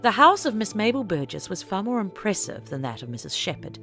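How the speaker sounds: usual speaking voice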